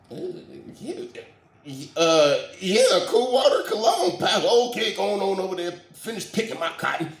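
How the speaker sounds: high, loud, eager-to-please yes-man; the servile deacon agrees with the pastor, then hurries off. Retold or imitated. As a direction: shuffle off like a bowing servant.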